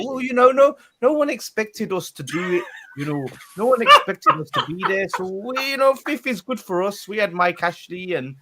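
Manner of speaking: high, loud mock Geordie; botched Geordie accent. Fan mimicking Pete's excuses, in a botched Geordie accent. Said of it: "hasn't even got a Geordie accent".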